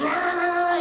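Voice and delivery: loud high mock ranting rah; loud mock rant noises; mock angry rah. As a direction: bark out rah like a mob. Mocking angry commenters.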